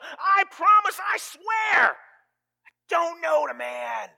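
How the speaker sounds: high shouted oath, dragging the last words slowly; cursing shout. Acted. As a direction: swear it at the top of your voice, desperate. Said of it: "called down curses upon himself".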